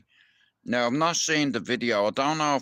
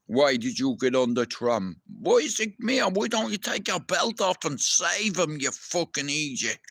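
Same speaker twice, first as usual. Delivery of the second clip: raised, higher voice telling off a bystander; a fed-up yell at someone who won't help; imagined angry rant; 7 semitones higher than usual. Retold or imitated. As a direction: let him have it, exasperated and heated. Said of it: "And I'm thinking".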